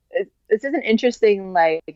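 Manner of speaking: ordinary talk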